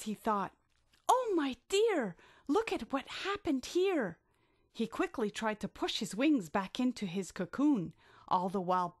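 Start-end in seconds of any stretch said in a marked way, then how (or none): 1.1-4.2 high fretting cry; oh-dear storybook dismay; dismayed surprise. Acted. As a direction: fuss over the mess, pitched up. Billy dismayed at the mess.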